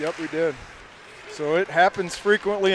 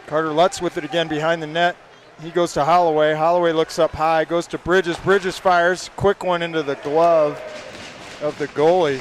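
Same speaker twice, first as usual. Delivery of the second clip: brisk live hockey call; play-by-play; 5 dB louder than usual. Acted. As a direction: call the action as it happens.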